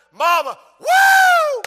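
high, loud whooping cry; ecstatic shout. Real, not acted. Preacher whooping as the widow's miracle sinks in. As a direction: let out a joyful whoop.

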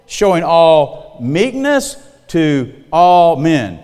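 drawn out, very slow, each word laid down; slow scripture reading. Shown as a demonstration. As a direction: read it slowly and solemnly. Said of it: "Boy, that's an inclusive statement".

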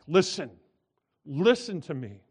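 loud, urgent double call of Listen; emphatic. Shown as a demonstration. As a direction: cry out Listen, listen with force. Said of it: "it's even more emphatic".

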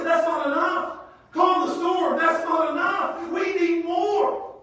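building preacher's refrain, rising to a shout; fiery sermon crescendo, from firm to full shout; preaching build to shout. Acted.

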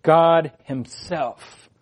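"God himself" very slow and deliberate; weighty, spaced-out "God himself"; emphatic. Shown as a demonstration. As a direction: draw out "God himself", each word set apart. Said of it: "we have this emphatic translation".

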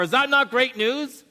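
high, loud rhetorical question; preaching. Acted. Pastor challenging his congregation, high and loud. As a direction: throw the question out to the hall.

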